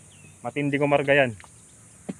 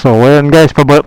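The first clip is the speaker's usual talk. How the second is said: ordinary delivery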